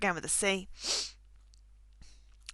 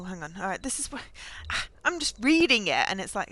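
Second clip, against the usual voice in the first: ordinary talk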